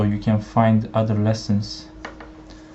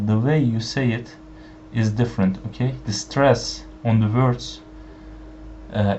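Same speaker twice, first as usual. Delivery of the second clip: ordinary talk, nothing marked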